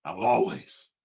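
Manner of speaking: low, soft mock-pained pledge; subdued, insincere promise; Floyd Mayweather. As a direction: recite it quietly, as if it hurts. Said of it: "I can see the pain in your eyes".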